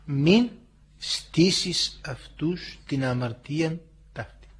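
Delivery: slow, softened scriptural quote; solemn prayer. Acted. Christ on the cross asks forgiveness, slow and soft. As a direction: pray it slowly and softly, word by word.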